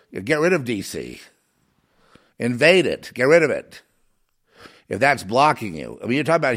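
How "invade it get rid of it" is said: ordinary talk, nothing marked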